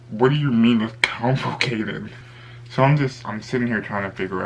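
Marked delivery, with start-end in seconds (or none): 0.1-2.1 mildly exasperated, disbelieving question; incredulous retort. Retold or imitated. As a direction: retell it as a baffled comeback. Baffled retort to evasive friend.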